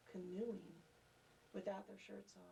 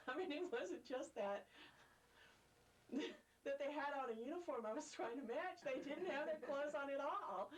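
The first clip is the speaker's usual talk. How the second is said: amused, pitch lifted, laughter in the words; laughing while telling; 6 semitones higher than usual. Real, not acted. Laughing as she tells the interviewer about the nude camp. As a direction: giggle through the memory of the naked campers.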